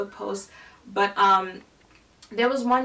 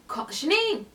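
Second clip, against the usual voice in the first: higher-pitched retold call; 7 semitones higher than usual. Guard hailing a coworker he likes, calling out. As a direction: imitate someone calling out a name.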